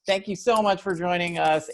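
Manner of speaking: high, giggly thanks; laughing through the sign-off. Real, not acted. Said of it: "Nope, leaving it in".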